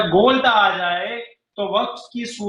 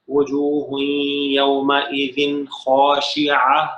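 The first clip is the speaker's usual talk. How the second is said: Quran recitation; 4 semitones lower, much slower than usual. Shown as a demonstration. Teacher reciting the next verse for the class, slow chanted recitation. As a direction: draw each word out like tajweed recitation. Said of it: "اب وہ خاشعة".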